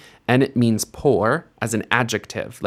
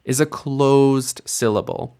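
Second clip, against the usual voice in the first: ordinary talk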